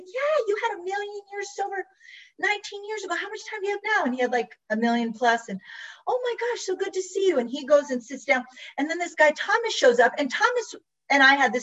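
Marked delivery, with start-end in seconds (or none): 0.0-4.0 high-pitched, delighted recognition; high, thrilled reunion voice; excited greeting. Retold or imitated. Happily greeting a long-sober acquaintance. 6.1-7.4 high, delighted hello; high, happy reunion voice; gushing greeting. Retold or imitated. Retold delighted greeting of old acquaintance.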